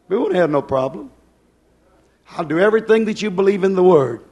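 loud, fervent pulpit preaching; preaching at full force. Acted. Preacher rousing his congregation with loud fervent preaching.

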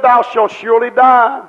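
ordinary talk, nothing marked

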